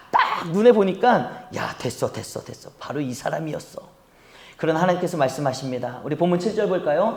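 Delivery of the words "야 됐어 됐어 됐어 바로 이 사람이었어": low, soft private thought; hushed self-assurance. Acted. Samuel sure he found the king, hushed. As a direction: murmur it low, as if to yourself.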